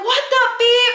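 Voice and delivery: high-pitched outraged yell; retold scream. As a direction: re-enact screaming at your boss. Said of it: "Like, I was screaming".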